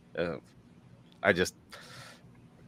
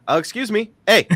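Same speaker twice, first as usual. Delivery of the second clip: much higher, hammed-up Canadian accent; bad Canadian impression; 12 semitones higher, 6 dB louder than usual. Canadian car fans interrupting, bad Canadian accent. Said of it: "horrible accent".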